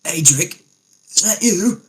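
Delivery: lightly voiced injured young man, rising high at the end; a barely conscious youth mumbling his brother's name; a hint of a half-awake boy, pitch climbing; weak mumble. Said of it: "and he just mumbles".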